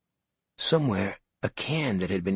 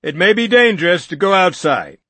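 higher, louder warning yell; 9 semitones higher, 11 dB louder than usual. Acted. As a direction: shout a warning over the room. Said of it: "Norton yelled".

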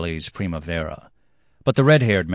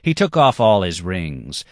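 plain reading voice

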